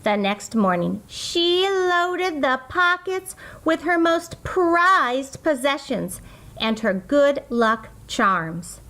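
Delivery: ordinary delivery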